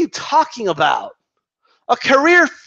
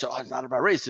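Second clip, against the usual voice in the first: "Oh, it's not about race." repeated low and much softer; muttered mock repeat; 7 semitones lower, 12 dB quieter, faster than usual.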